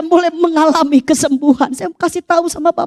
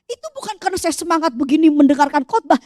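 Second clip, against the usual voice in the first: normal talking voice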